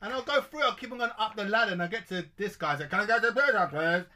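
high, playful put-on voice; silly high voice. Storytelling in high prank voice.

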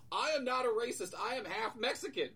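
high-pitched, acted-out protest; indignant outburst. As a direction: announce it indignantly to the whole class. Retold outburst to the whole class.

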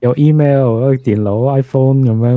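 put-on Hong Kong Cantonese accent with English words dropped in. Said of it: "That's the Hong Kong accent".

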